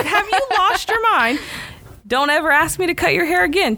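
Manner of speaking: a fed-up wife laying down the law; wife to husband after the haircut, indignant. Retold or imitated. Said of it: "looked at him sideways".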